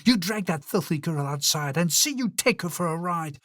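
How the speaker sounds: a cruel queen giving a brutal command; angry shout. Acted. Queen ordering the huntsman to kill the girl, shouting. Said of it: "She shouted at him".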